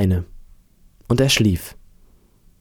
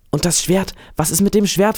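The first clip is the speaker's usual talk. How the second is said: put-on high-pitched boy's question; curious boy, pitched well up; eager boy; 8 semitones higher than usual. A narrator's reading. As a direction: ask keenly in a raised young voice. Boy eagerly asks about the sword.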